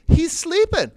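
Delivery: high, loud mock complaint; objection. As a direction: protest as the prostitute, voice shooting up. Voices prostitute's objection.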